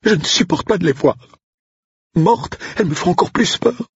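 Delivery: high, pleading, terrified voice; frightened cook. Acted. Cook begging Andrew to cover the body, high terrified plea. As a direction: beg him in a high frightened voice. Odile explains her fear.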